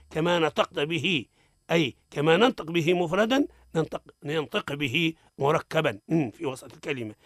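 usual speaking voice

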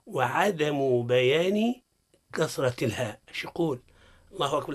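ordinary talk

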